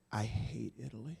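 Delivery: very soft, deadpan I hate Italy; deadpan provocation. Retold or imitated. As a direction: say it low and quiet to provoke. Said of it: "right in the eyes and going".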